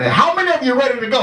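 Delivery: mock preacher rising high in pitch; preacher's altar call. Preacher asking the congregation who is ready, high-pitched appeal. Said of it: "this is what he was doing".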